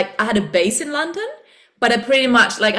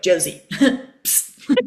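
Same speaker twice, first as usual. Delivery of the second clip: deep inner voice; 3 semitones lower, much slower than usual. Retold or imitated. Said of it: "for some reason, it's quite a deep voice".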